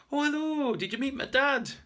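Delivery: very high, loud woman's character voice; excited greeting. Acted. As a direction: call out a delighted hello on arriving. Sybil bursts in greeting.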